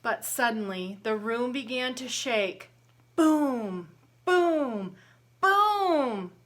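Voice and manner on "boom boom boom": loud, high booming sound effect; booming footsteps. A narrator's reading. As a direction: boom out each step like a giant walking. Giant's footsteps in story.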